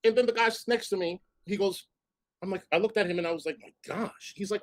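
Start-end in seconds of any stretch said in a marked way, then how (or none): 3.6-4.3 breathy whisper of disbelief; a stunned whispered aside. Retold or imitated. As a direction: whisper it under your breath.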